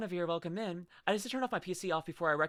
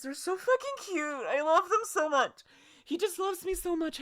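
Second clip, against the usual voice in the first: high, squealing puppy gush; gushing over the puppy; 11 semitones higher, 3 dB louder than usual. Real, not acted. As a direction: gush like the dog is right there.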